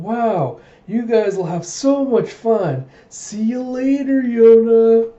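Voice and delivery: high, slow, drawn-out mock cheer; oblivious cheerful friend. Easygoing friend waving off the princess, exaggeratedly cheery.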